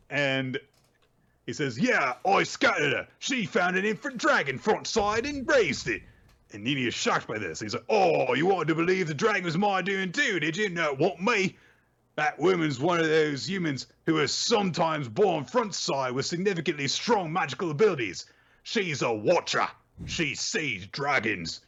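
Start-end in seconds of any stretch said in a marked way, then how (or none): 1.8-6.0 high, smug put-on villain voice; mock douchey Bruno. Retelling the show, Bruno's reply voiced smug and high. 7.9-21.6 high, smug put-on villain voice; mock douchey Bruno. Retelling the show, Bruno's reply voiced smug and high.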